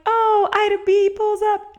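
excited. Retold or imitated. Shocked retelling of scene.

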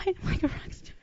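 high, soft, breath lost to laughter; laughing. Real, not acted. Echoing a friend's rock star compliment, laughing. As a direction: squeeze it out through a laugh.

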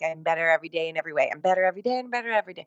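reciting mindlessly while checking a watch; fast, soft, empty affirmation; rote bored mumble. Retold or imitated. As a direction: rattle it off fast and soft, no feeling. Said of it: "you're looking at your watch and you're like".

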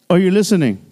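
usual speaking voice

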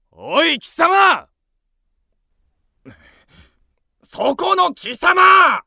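slow, loud challenging call across the street; angry shout. Acted. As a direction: call him out, drawn-out and loud. Calling out to a rival. (大声で呼び止める怒鳴り声)

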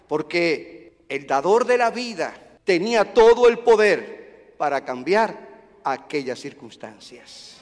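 normal talking voice

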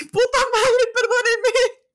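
shrieks high and loud through a laugh; laughing yelp at her own mistake; embarrassed outburst. Real, not acted. After mixing up the band names, a laughing shriek. Slips on band member's name.

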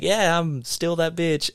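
high, slow sassy put-on voice; mock-confident singer voice. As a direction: camp it up, high and drawn out.

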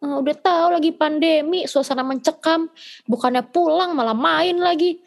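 imitating scolding mom. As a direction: play mom scolding, lightly put on. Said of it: "nyokap gue ngechat".

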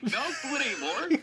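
laughing at a friend's mistake; exasperated. Retold or imitated. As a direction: shout him down through laughter. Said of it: "I was just like".

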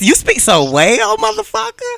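high laughing praise. Real, not acted. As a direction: laugh through the compliment.

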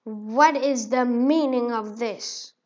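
slow, loud booming challenge; slow, loud royal roar; booming demand. Acted. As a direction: boom it out like a king. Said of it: "a very loud voice boomed".

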